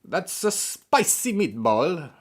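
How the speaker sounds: high, slow, hammed-up Italian accent; mock Italian accent. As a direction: draw it out in a cartoonish Italian voice. Continues hammy Italian accent joke.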